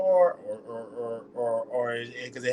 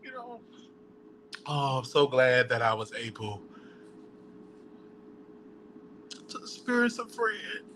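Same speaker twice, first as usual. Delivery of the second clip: fighting back sobs; quiet choked-up crying; on the verge of tears; 7 dB quieter than usual. Real, not acted. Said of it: "I didn't wanna cry".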